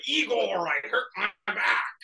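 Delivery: hushed mock wail; imitated complaining old Count. Voices the Count calling Igor.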